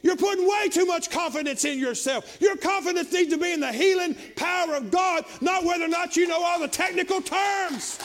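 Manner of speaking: loud, high, rising preacher's cry; preaching at full force. Acted.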